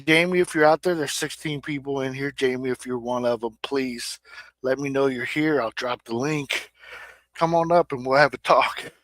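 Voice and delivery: ordinary talk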